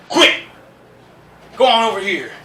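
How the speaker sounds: loud, high command to a horse; cowboy holler at horse. Shown as a demonstration. Rancher shouting at a horse he pushes. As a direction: bark it like a rancher pushing a horse. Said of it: "push him in the chest".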